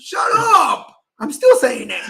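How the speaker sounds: loud, high playful yell; mock outrage. Host snapping back at co-host's teasing about diet, mock outrage. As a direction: yell back in pretend indignation.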